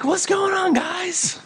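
just woken from a nap, mildly puzzled; sleepy Jesus. Acted. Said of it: "It's like".

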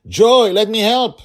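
high, loud, slow call over the wind; calling out to help a falling child; urgent shout. Acted. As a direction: shout to be heard through wind.

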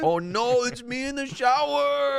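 high, slow hammed-up dismay; high mock-horrified squeal; mock horror. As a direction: squeal it like you've been caught out. Mock horror at own dub.

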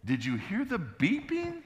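very high, slow, squeaky-pitched question; comic high-pitched exasperation; incredulous outburst. Retold or imitated. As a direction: shoot the voice way up in disbelief. Acting out his exasperated reaction afterwards.

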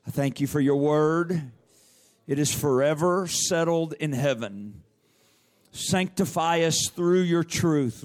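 loud, high, slow praying; fervent worship prayer. Acted. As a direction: pray out loud to God in worship.

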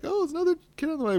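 very high, fast mock gush; mock excitement. Podcaster mimicking listeners thrilled about a new baby, in a very high fast mock gush. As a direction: gush it like a giddy fan of baby news. Imagined listeners getting hopes up.

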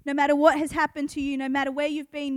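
normal talking voice

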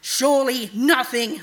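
loud, slow, scornful rebuke; raging. A narrator's reading. Night god rejects Araneth's objection, raging. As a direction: cut the servant off with wrath. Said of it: "the night god raged".

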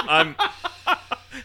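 words breaking into laughter; laughing. Real, not acted. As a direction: laugh through it. Laughing at friend's stupid purchase.

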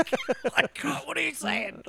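laughing high and incredulous. Real, not acted. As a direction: laugh through the words in disbelief. Laughing at friend's mistake.

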